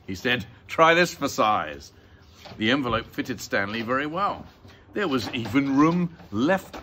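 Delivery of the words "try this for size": loud, slow fatherly offer; raised voice, stretched words; father presenting. Acted. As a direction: present it with a flourish, drawn out. Father presents envelope to son.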